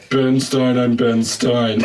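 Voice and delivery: slow, drawn-out monotone impression; Ben Stein. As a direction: drone the name on one level note. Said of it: "do it in Ben Stein's voice".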